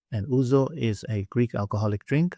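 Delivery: normal talking voice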